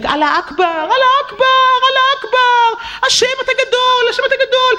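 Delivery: high chanted call to prayer. Retold or imitated. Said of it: "והוא צועק".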